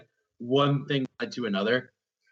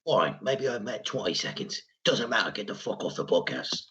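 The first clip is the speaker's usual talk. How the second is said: gruff tough guy; 4 semitones lower than usual. Acted. Statham voice continued.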